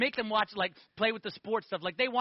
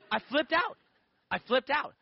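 louder, higher, rising; flipped out; 5 semitones higher, 4 dB louder than usual. Shown as a demonstration. Man telling how he panicked at the question, louder. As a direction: say it like you're losing it.

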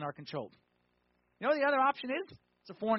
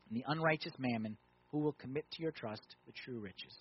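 scripture reading; 4 semitones lower than usual. Acted. Preacher reading the Luke verse to the congregation, low and measured.

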